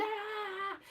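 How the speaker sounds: usual speaking voice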